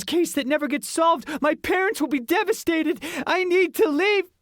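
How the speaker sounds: put-on high, fast, frantic twelve-year-old; a terrified boy spiralling into panic; squeaky panicking kid. A narrator's reading. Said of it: "a squeaky voice echoed".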